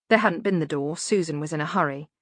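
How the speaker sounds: ordinary delivery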